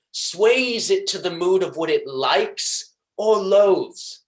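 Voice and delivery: high, forceful villain gloating with hatred; Shylock. Acted. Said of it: "hatred and pleasure that I tried to deliver this monologue".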